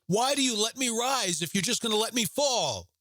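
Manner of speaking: high shouted plea; cry out to God. Acted. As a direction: shout the question at heaven. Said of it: "scream at God".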